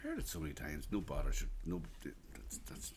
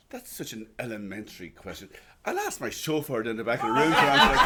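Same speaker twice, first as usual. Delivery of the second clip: punchline delivered while cracking up, getting louder; can barely finish the line for laughing; laughing through the punchline; 4 semitones higher, 14 dB louder than usual. Retold or imitated. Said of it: "He says, he says".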